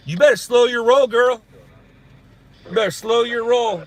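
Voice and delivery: put-on wag a finger at her, girl; sassy warning.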